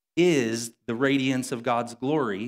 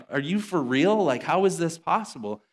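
higher-pitched disbelief; mock astonishment; 6 semitones higher, 3 dB louder than usual. Gym crowd watching the cleaner deadlift, mock astonished. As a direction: act out stunned onlookers, pitched up. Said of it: "they're, like, losing their minds".

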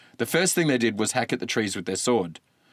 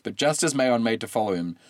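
ordinary delivery, nothing marked

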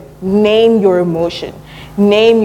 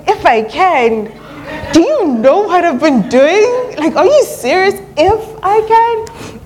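high-pitched, drawn-out incredulous protest; mock offended; 11 semitones higher, slower than usual. Preacher acting out being Jesus doubted by a father, mock offended. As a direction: play indignant disbelief, pitch soaring on the questions. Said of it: "I'd be so offended if I was Jesus".

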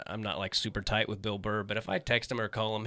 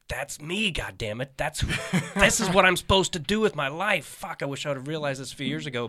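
excited realization; 5 semitones higher, 8 dB louder than usual. Retold or imitated. Recalling reading the book, elated.

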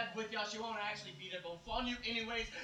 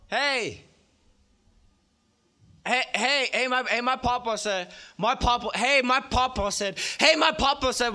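loud call; 13 dB louder, slower than usual. Acted. Poet opens with shouted call.

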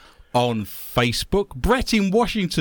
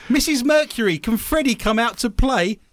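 lightly voiced, higher-pitched young friend; child calling for a playmate; 7 semitones higher than usual. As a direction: ask hopefully, pitched up, at a friend's door. Plays Freddie's friend calling on his mother.

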